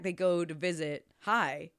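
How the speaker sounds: usual speaking voice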